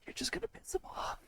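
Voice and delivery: hushed whisper aside. Real, not acted. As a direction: whisper it to your friend. Aside to fellow player.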